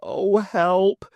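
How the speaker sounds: high, loud mock call for help; hammed-up help cry. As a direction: yelp it like a panicked chimp. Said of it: "more like".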